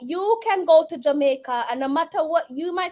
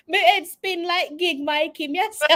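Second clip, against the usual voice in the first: ordinary talk, nothing marked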